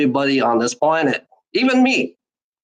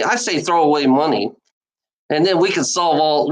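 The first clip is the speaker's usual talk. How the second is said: usual speaking voice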